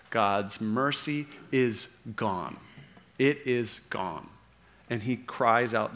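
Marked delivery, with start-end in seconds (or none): 0.1-4.3 slow, softer, grave delivery; solemn slow sermon emphasis; slow and heavy. Real, not acted. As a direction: let each word land, slowly. Preacher slows for weight.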